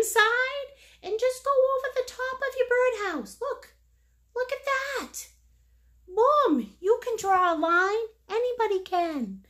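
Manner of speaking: high encouragement. Real, not acted. Encouraging a nervous first-timer.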